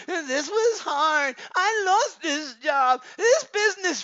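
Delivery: slow, high mock sob over lost job; mock crying. Preacher mocking men weeping over a failed business. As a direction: whine it out like a man crying over failure.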